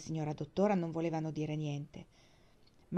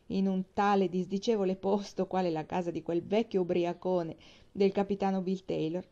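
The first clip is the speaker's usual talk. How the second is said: plain reading voice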